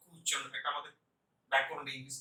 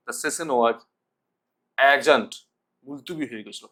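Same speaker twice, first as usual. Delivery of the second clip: normal talking voice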